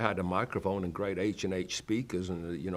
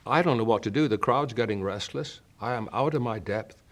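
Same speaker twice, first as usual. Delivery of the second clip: normal talking voice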